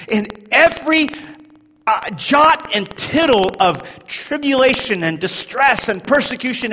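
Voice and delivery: high, loud shouted sermon; preaching at full force. Acted. Preacher proclaiming Romans eight to the church, shouting. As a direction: thunder Paul's words at the congregation. Preaching Paul's words to congregation.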